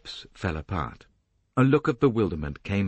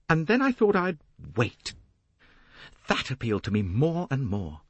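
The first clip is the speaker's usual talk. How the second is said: high, eager confession, relishing it; vengeful Jacqueline; 7 semitones higher than usual. A narrator's reading. Jacqueline confessing her revenge plan to Poirot, high and gloating. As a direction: lift the pitch and savour the revenge.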